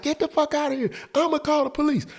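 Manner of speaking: very high put-on female voice; yelling out the window. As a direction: threaten him in a mock screech. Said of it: "She yelling out the window".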